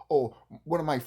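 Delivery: ordinary delivery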